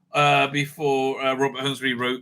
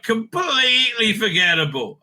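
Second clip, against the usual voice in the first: higher and louder, almost a shout of disgust; exasperated outburst; 7 semitones higher, 3 dB louder than usual. Real, not acted. Reviewer dismissing an audio story, exasperated half-shout. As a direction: blurt the verdict out in exasperation. Repeats verdict with a sudden outburst.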